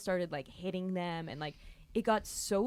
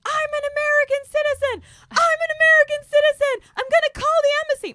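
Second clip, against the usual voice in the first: hysterical screaming; 16 dB louder than usual. Retold or imitated. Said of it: "I start screaming and crying hysterically".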